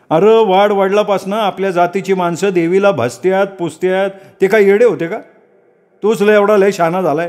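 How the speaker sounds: high-pitched, scolding villager; indignant villager. Acted. Villager defends ancestral custom. (चिडलेला, उंच आवाजातला गावकरी)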